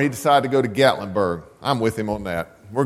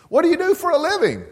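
much higher and fast, lightly voiced old man; old man's question; faster than usual. Old man asking the professor his job, quick high voice. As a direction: toss off the question brightly.

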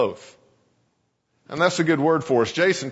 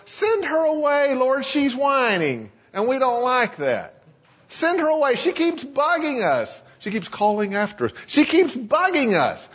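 whining disciples; 8 semitones higher than usual. Retold or imitated. Disciples whining to Jesus about the woman, mock-whiny. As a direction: whine like petulant children. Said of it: "They had to whine".

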